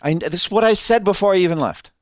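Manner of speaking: I-told-you-so at God; exasperated Jonah. Retold or imitated. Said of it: "he said it about like that".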